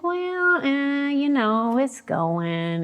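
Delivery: high, drawn-out hemming and hawing; evasive hedging. Retold or imitated. Woman dodging a job hunt question, high reluctant hedging. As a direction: stretch it out slowly up high, avoiding the answer.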